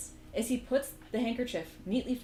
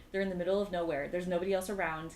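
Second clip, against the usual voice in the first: ordinary talk, nothing marked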